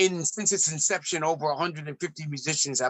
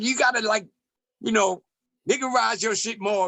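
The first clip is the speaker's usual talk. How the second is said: pitched higher, lightly mocking the producer's demand; 6 semitones higher than usual. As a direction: ridicule his request in a raised voice. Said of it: "he's like".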